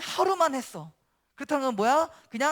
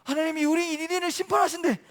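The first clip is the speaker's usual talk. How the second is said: loud, high alarmed commands; rally the people as a panicked king; acted royal alarm, raised voice; urgent king; 6 semitones higher, 6 dB louder than usual. King rallies city in emergency.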